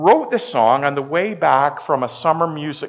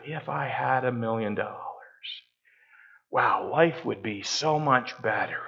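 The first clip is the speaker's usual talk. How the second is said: wistful daydream; 4 semitones lower, 6 dB quieter than usual. Pretend. Voices a wistful coveting daydream.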